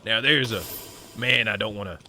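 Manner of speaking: lightly voiced southern cowboy; a hint of a southern cowboy accent. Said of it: "talking like this".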